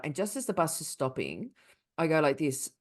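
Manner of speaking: ordinary delivery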